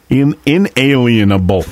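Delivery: "In-, inalienable" with a hard punch on In; "inalienable" slowed down, in stressed; stressed correction. Shown as a demonstration.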